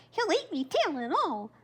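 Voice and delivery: high, squeaky scared hare. A narrator's reading. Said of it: "Hare thought".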